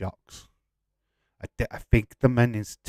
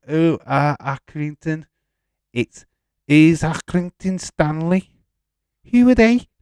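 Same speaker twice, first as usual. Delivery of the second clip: put-on advert kid; 7 semitones higher, 9 dB louder than usual. Quoting the famous milk advert.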